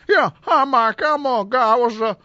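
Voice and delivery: high mock caller voice; teasing caller imitation. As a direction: tease with a put-on, high-pitched caller voice. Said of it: "You know I'm just teasing you".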